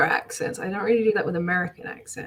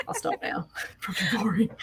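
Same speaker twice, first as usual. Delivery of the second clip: whispered self-deprecating aside with a laugh; sheepish whisper; 5 dB quieter than usual. Real, not acted. Speaker apologising for talking about her accent, sheepish whisper. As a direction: drop to a near whisper, apologetic.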